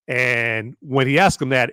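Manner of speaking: normal talking voice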